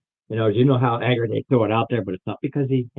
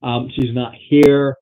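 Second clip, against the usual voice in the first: loud reluctant protest; 3 semitones higher, 9 dB louder than usual. Acted. NPC reluctant outburst.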